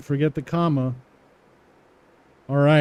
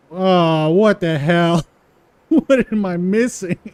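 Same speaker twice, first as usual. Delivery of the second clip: louder and higher, laughing in disbelief; surprised outburst; 4 semitones higher, 7 dB louder, slower than usual. Real, not acted. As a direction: react to the bug with a loud, baffled laugh. Surprised by a bug.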